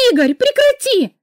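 high, loud panicked cry; terrified scream. A narrator's reading. Girl screaming at her brother in terror to stop. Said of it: "закричала я от ужаса".

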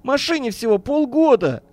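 high, whining protest; tearful lament. A narrator's reading. Businessman mourns his nearly new car, plaintive and tearful. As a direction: keep wailing, pitched well up. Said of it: "со слезами на глазах причитал Бирюков".

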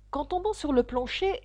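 usual speaking voice

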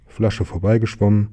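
ordinary delivery

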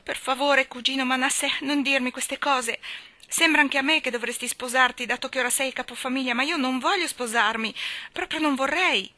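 high, pleading voice on the edge of tears; young girl near tears, pleading with her cousin; almost crying. A narrator's reading. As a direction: beg him to stop, close to tears. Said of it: "quasi piangendo".

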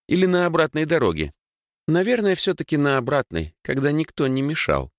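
put-on high-pitched girl's voice, needling her brother; teasing little sister. A narrator's reading. (голос младшей сестры, высоко, с подначкой)

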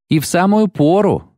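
high-pitched, theatrical exclamation; excited cry. A narrator's reading. Dandelion arriving with the pilgrims, excited cry. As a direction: call it out with a flourish. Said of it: "крикнул подходящий ... Лютик".

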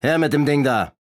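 curt, loud storybook villain; gruff robber's shout. A narrator's reading. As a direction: call out roughly like a bullying robber. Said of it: "eine barsche Stimme rief".

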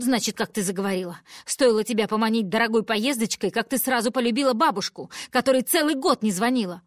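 loud hateful tirade; furious rant. Acted. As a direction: pour out venom at the daughter. Mother berating daughter. (мать злобно кричит на дочь)

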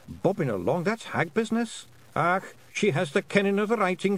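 high, lilting Scots wee-man voice; a tiny Scots rogue, awed by the writing; Feegle voice. Acted. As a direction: Feegles murmuring in awe at the pencil, high Scots voice. Feegles murmur over the pencil.

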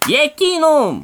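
put-on playful old-man rebuke; scolding exclamation. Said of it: "했어요".

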